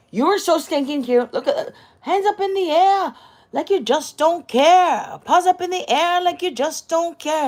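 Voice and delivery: high, loud singsong pet voice; baby talk to dog. Acted. Owner cooing at her dog, high singsong baby talk.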